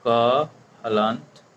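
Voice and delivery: very slow क हलंत, the क clipped with no vowel after it; drawn-out teacher demo of क हलंत; क with halant. Shown as a demonstration. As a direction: sound out क हलंत slowly, the bare consonant. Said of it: "क किस चीज़ से मिलके बना हुआ है?".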